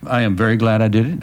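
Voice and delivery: ordinary delivery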